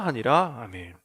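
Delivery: ordinary talk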